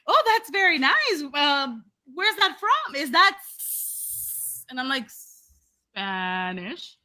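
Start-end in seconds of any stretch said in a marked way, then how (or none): 0.1-4.7 high, drawn-out nosy politeness; front desk lady. Retold or imitated. Receptionist asking where the surname is from, high and slow. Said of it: "this woman just goes". 5.9-7.0 low, softer, questioning rise; wary answer. Retold or imitated. Guest telling receptionist her name's origin, low and wary.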